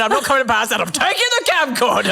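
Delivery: mock dad.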